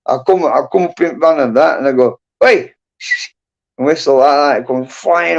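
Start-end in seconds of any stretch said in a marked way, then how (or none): 2.4-2.7 high-pitched call to a horse. Retold or imitated. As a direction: hail the horse with a sharp shout. Calling out to his horse.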